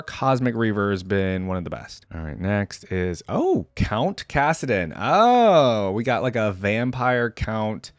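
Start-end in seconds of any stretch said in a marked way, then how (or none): none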